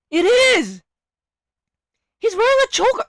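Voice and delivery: shouting it out, pitch shooting up high; yelling in delighted disbelief; excited shout. Real, not acted. Streamer to chat, spotting the choker: loud excited shout.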